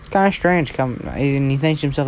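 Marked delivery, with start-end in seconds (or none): none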